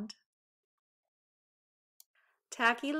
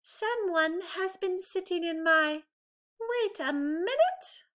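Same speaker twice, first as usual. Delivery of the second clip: higher, puzzled mother bear voice; Mama Bear, catching herself; 9 semitones higher than usual. A narrator's reading. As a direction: stop short as if something is odd. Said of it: "Mama Bear said".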